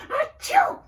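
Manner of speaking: loud playful pretend sneeze; a big hammed-up achoo; kids' song mock sneeze; fake sneeze.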